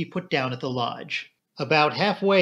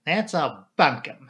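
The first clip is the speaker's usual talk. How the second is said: ordinary delivery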